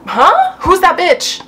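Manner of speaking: high, loud, suspicious huh; shocked disbelief. Retold or imitated. Girlfriend hearing women in the background, high and indignant. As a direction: react like you just heard another woman.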